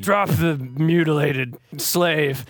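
slow, commanding villain order. Acted. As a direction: order it coldly, slowly.

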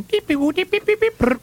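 high squeaky beep beep noises; playful high beeps and a brr; car beep imitation. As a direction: mimic a car horn tooting as it drives off. Imitating the car beeping away.

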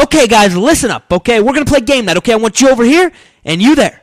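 loud, high, bossy game-night host; rally the group with big energy; animated host impression. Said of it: "but it's very animated".

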